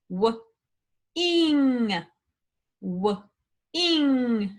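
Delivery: B, r, a, th said apart; segmenting sounds. Shown as a demonstration. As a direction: break broth into its sounds. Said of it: "break these words down into sound".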